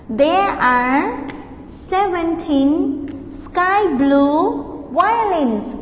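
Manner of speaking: split, slow repeat; slow model answer. Shown as a demonstration. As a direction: pause after there are, then slow. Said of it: "practice repeating after me".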